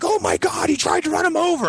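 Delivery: high-pitched imitation of a shocked mom; mock-hysterical bystander voice; horrified mother. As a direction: pitch it way up, aghast.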